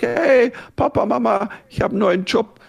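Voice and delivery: excited son. Retold or imitated. Son phones parents with news.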